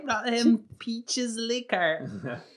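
high, drawn-out mimicry of PEACHES; mock how he said "peaches"; imitating his delivery. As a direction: teasingly stretch out "peaches liquor", pitched high. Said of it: "He said it like".

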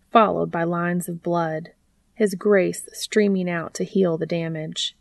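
plain reading voice

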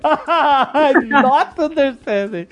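high, drawn-out echo through laughter; laughing mimicry. Host mimicking a friend's broken English, laughing. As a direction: stretch it out mockingly while cracking up.

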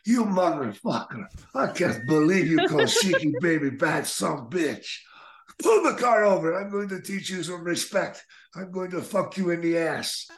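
hammed-up rage with a broken English edge; angry Iron Sheik. [name] retelling the Sheik's car outburst, mock furious yell. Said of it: "and he's like".

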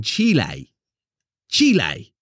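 Chile as drawn-out "CHEE-lay"; showy Spanish-style Chile with full final "ay"; pretentious pronunciation. Retold or imitated. Best man mocking friend's pretentious word, showy pronunciation. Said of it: "proper pretentious then".

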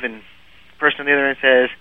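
normal talking voice

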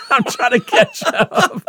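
high, slow, laughing line. Real, not acted. Telling friend about ordering twelve shots, laughing. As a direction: laugh your way through it.

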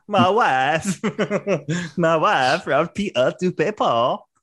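put-on laughing through a mangled Borat accent; Borat-style accent. Cracking up mid-impression to mock Tate, Borat style. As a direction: mash Borat into the British voice, giggling.

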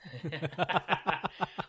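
a real amused laugh; burst of laughter. Real, not acted. Listener reacts to funny story.

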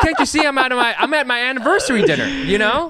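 high, laughing indignant protest; mock outrage. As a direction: act offended at dinner. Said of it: "outraged diner".

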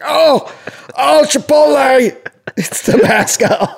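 high, loud, strangled cry; hammed-up yelp of a man in pain; choking stalker. Joke: stalker hit by bad breath.

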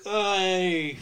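put-on light Queens accent greeting; a casual Queens-accent hey. Imitating Ray Romano's Queens accent. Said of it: "Queens voice".

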